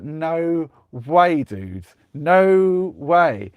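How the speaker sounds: loud, high, incredulous no; acted-out adamant disbelief; emphatic retold refusal. Retold refusal of huge bet.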